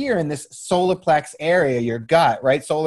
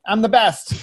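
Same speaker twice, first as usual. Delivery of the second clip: imitating a boastful son, higher pitch; a parent's mock brag, pitched up; boastful child; 5 semitones higher than usual. Father mimics his son who never doubts himself, cocky. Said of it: "even when he's terrible".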